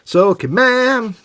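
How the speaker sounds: high, slow "Sulky man" drawn out; teasing echo of the sulky man line; mock sulky echo. Echoes the sulky man joke.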